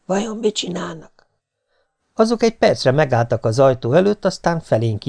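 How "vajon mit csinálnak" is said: soft, high hushed question; hushed, secretive question; whispered. A narrator's reading. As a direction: whisper it to your partner in hiding. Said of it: "súgta a feleségem".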